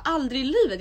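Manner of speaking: high, loud indignant refusal; irritated refusal. Acted. Refusing a kiss on the mouth, irritated and loud. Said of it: "blev så jävla irriterad".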